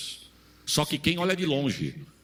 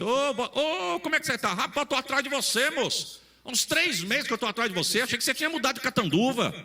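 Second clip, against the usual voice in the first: put-on high, eager voice of an old friend finding him; the friend gushes, thrilled to see him at last; excited greeting; 9 semitones higher than usual. Friend in the car greets the jobless man, high and excited.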